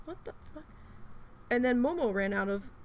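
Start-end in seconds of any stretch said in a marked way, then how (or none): 0.1-0.6 bewildered. Retold or imitated. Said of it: "I was like".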